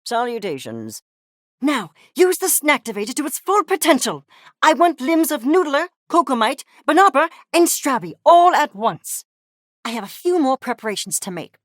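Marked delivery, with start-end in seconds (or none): 1.6-9.2 loud, high, fervent orders; emphatic command. Acted. Scientist demanding the Snacktivator at full potential, fervent command. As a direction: fire out the order, louder and higher.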